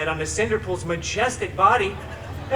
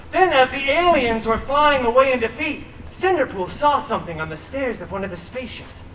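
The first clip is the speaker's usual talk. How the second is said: ordinary talk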